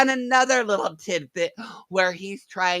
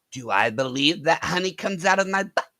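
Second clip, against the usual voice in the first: usual speaking voice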